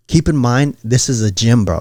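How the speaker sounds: ordinary talk, nothing marked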